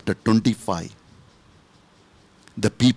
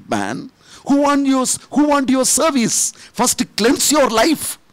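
the Lord rebuking; 6 semitones higher than usual. Retold or imitated. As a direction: start steady, then climb into a ringing scolding. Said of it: "The Lord said".

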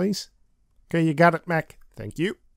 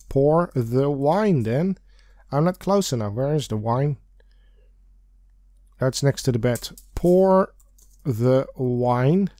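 ordinary delivery

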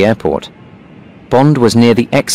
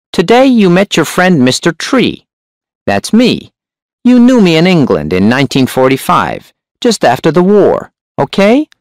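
high, sharp hunchback voice; 9 semitones higher than usual. A narrator's reading. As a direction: speak sharp and high, pitched up. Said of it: "The hunchback's voice was sharp and high".

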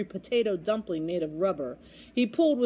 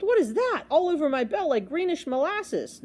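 high, louder startled outcry; startled gulp; 7 semitones higher, 3 dB louder than usual. A narrator's reading. Bell ringer finds his bell coated, startled. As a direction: exclaim in horrified surprise. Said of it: "he gulped".

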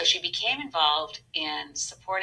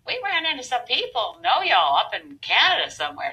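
put-on Southern accent; 7 semitones higher, 4 dB louder than usual. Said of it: "soft Southern accent".

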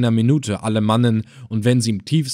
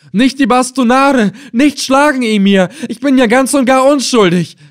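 much higher, loud, desperate pleading; terrified scream; 13 semitones higher, 7 dB louder than usual. A narrator's reading. Old helmsman begging the emir not to be beaten, terrified scream. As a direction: scream for mercy in terror. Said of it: "erschrocken ... und schrie".

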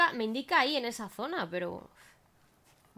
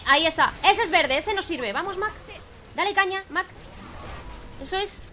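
higher, louder eager cheering; excited; 8 semitones higher, 7 dB louder than usual. Real, not acted. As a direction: urge the robot on, thrilled. Found target, urging companion on. (emocionado, animando en voz alta)

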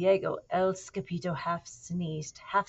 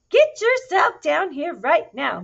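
loud, high, slow scolding command; ordering mother; 10 semitones higher, 12 dB louder than usual. Acted. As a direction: call the kitten down like a cross mum. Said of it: "ordered Mama Junebug Jones".

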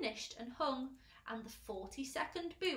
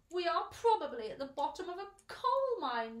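loud, higher-pitched gloomy complaint; 7 semitones higher, 8 dB louder than usual. A narrator's reading. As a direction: moan it as the pessimist of the group. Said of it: "the earthworm said gloomily".

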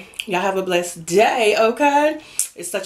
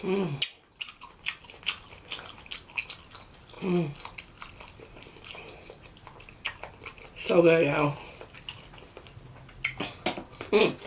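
chewing while talking; muffled words between bites; mouth full; 3 semitones lower, 11 dB quieter than usual. Real, not acted. Seen 2: Bites green stalk at start, mouth closed chewing while holding it.

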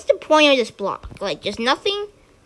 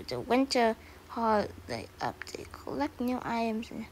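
whisper; 3 semitones lower, 13 dB quieter than usual. Real, not acted. Reading the update notes.

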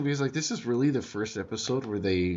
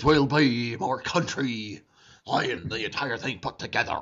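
put-on weird pirate accent. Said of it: "Davy Jones impression".

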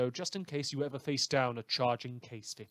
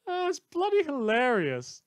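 much higher and louder, tickled; amused; 13 semitones higher, 7 dB louder than usual. Real, not acted. Host to listeners, gleeful over the villain's lost jaw. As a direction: burst out, delighted by the joke.

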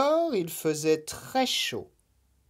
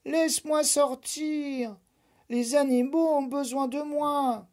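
pleading; 12 semitones higher than usual. Acted. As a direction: beg to be let out. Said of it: "supplia la Nuit".

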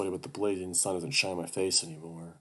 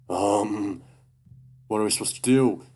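louder, rising panicked question; 3 semitones higher, 7 dB louder than usual. Acted. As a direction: voice racing with fear. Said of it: "My voice races and pitches".

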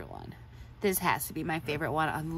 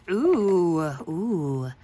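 delighted ooh; 2 semitones lower, 5 dB louder than usual. Real, not acted. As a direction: coo over a surprise gift. Delighted reaction to unwrapped gift.

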